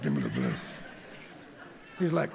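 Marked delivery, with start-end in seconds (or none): 0.0-0.6 very deep, growly tough-guy voice; mock macho customer. Customer insisting to the shop guy, mock macho. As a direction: answer in an exaggerated low rumble. Customer answers in same low voice.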